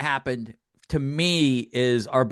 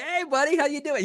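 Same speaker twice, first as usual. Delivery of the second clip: excited greeting; 12 semitones higher than usual. Retold or imitated. Greeting an old friend.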